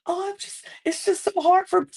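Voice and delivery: high-pitched, whimpering innocent act; hammed-up helpless whine; mock tearful. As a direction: imitate a sweet woman playing the weepy victim. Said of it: "who keeps crying all the time".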